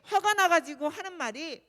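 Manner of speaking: ordinary talk, nothing marked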